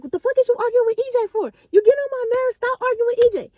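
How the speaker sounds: high, fast mock scolding; playful scolding. Narrator snapping at friend, high fast mock scolding. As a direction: scold her high and fast, half joking. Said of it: "I'm like".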